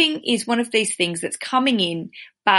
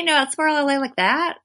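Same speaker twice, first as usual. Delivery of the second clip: higher-pitched mock L.A. accent; dumb L.A. accent; 6 semitones higher than usual. Shown as a demonstration. Host trying out accents for her American guest, dumb L.A. accent. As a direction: push the L.A. accent until it sounds dumb. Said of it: "sound dumb?".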